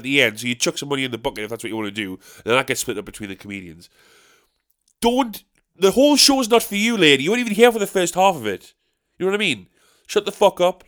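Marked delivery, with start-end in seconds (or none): 5.0-10.8 loud, high furious telling-off; angry telling-off. Retold or imitated. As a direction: let the heckler lady have it. Ranting at heckler lady.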